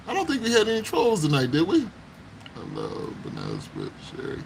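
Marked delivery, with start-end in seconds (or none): 2.6-4.4 very low, quiet, dragged-down voice; deep voice. Pretend. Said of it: "Girl, stop talking like that".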